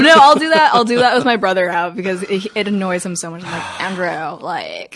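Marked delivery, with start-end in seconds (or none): 3.8-5.0 put-on Valley Girl voice. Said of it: "I will speak in a Valley Girl voice".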